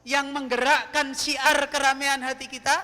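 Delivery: loud rousing sermon opening; full-force preaching. Acted. (ceramah berapi-api dengan suara lantang)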